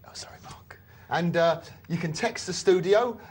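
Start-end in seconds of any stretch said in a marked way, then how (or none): 0.1-0.8 hushed whispered apology; whispering. Real, not acted. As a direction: drop to a whisper. Apologetic aside after a rebuke.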